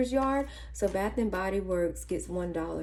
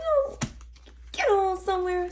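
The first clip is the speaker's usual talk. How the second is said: a very high, sassy brush-off; dismissive brush-off; 11 semitones higher than usual. Retold or imitated. Telling the old lawn guy to go away, a high sassy dismissal. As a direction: wave him off with sass, pitched way up.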